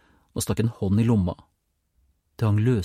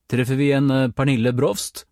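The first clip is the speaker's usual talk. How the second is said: louder official call; calling into the house; 5 semitones higher, 5 dB louder than usual. A narrator's reading. (roper navnet inn i huset)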